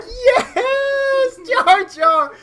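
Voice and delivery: very high, drawn-out mock cheer; hammed-up overjoyed yell; ecstatic. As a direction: shriek it in stoned triumph. Stoned Lucas celebrates the name.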